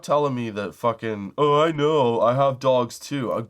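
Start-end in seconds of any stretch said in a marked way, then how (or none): 1.4-3.3 louder, stretched-out mock chumminess; exaggerated mimicry of unwanted sympathy; mocking know-it-all. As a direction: parrot an overeager fellow dog owner. Mocking people who give dog advice.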